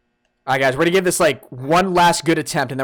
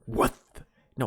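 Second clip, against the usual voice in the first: usual speaking voice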